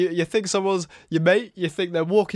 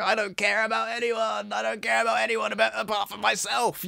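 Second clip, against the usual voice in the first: lightly voiced selfish Chelsea, a bit higher; a hint of a careless impression; mocking Chelsea; 5 semitones higher than usual. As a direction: mimic her selfish brush-off. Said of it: "Chelsea Leart being like".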